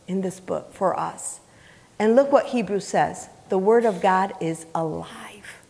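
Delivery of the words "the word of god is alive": ordinary talk, nothing marked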